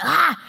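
short loud high yell; explosive acted cry of emotion; mock roar. Shown as a demonstration. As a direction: burst out in a sudden mock roar. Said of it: "when the roar comes out".

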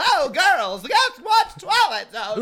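very high, loud, slow prim headmistress; old TV headmistress. Retold or imitated. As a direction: sing it out like a posh schoolmistress. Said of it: "an old T V headmistress".

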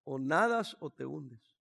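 "O nadas o te hundes" drawn out slowly and low; slow, low murmur. Retold or imitated. Pastor murmuring into his friend's ear, slow and low. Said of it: "me dice aquí en el oído".

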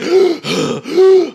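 sharp high gasp for breath; loud winded gasp. Retold or imitated. Taylor catching his breath after the fight, loud gasp. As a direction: suck in air after the struggle. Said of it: "he's breathing".

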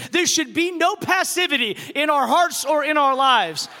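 building sermon delivery, rising to shouts; preaching. Acted. Pastor preaching to his congregation, building to shouts.

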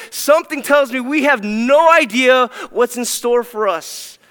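loud, high preaching build; preaching at full force. Real, not acted. Preacher rising to a loud climax for the congregation. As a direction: swell the voice as the sermon peaks.

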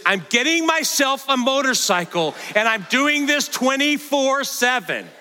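high-pitched, gleeful declaration; imitated excited granddaughter. Grandfather imitating granddaughter vowing to get a motorcycle, high excited voice. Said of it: "she followed up with this".

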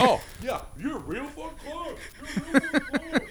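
very high mock voice; hammed-up falsetto jeer; mocking imitation. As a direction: squeak it out as the man backing off. Imitates the man backing away.

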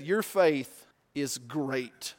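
each word drawn out slowly; slow emphasis. Real, not acted. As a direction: let it land slowly. Slowed quote for emphasis.

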